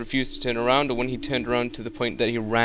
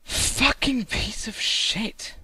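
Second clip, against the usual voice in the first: high, slow dragged-out curse; frustrated curse; 10 semitones higher, much slower than usual. Real, not acted. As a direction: stretch the curse out in disgust. Raging at the game.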